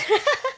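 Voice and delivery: short high-pitched laugh. Real, not acted. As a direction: laugh at your own joke. Laughs at own joke.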